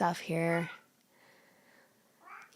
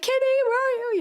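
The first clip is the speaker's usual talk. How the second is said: high, louder anxious call; calling the cat; 8 dB louder, much faster than usual. Retold or imitated. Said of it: "I'm like".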